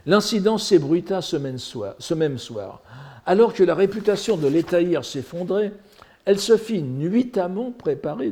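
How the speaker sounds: declaimed narration with lively quotes; animated story reading. Acted. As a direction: read the comic tale with relish. Reads translated story aloud.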